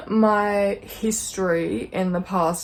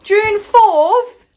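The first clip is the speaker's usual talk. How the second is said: high, loud, aghast question; can't believe the date she just read; shocked disbelief; 12 semitones higher, 12 dB louder than usual. Real, not acted. Reads disappointing release date.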